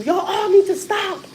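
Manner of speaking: high, slow, pleading schoolgirl cry of stop; daughter's high, drawn-out plea to stop; daughter telling class off. Retold or imitated. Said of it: "she had told everybody in the classroom".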